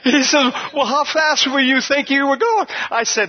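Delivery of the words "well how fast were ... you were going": high-pitched, tickled questioning; amused cop. Retold or imitated. Laughing officer quizzing the pulled-over driver, amused disbelief. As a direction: imitate a cop cracking up mid-question. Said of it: "he starts laughing again".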